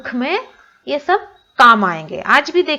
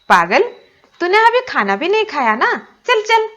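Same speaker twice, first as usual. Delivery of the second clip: put-on higher, louder fond scolding from an old granny; teasing granny; 10 semitones higher, 5 dB louder than usual. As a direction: shoo him off to eat, teasing. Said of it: "दादी माँ smiled".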